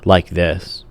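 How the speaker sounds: Shatner impression. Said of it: "William Shatner would always talk".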